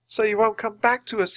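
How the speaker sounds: grieving. Acted. Teddy cradles the stone penguin, grieving. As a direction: lament to someone who is lost.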